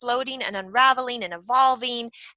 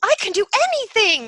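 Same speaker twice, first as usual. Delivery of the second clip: mock eager; 9 semitones higher, 4 dB louder, faster than usual. Mocking naive new foster parent.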